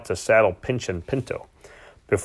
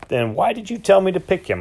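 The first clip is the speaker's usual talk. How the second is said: snapping; 8 semitones higher than usual. A narrator's reading. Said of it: "I snapped".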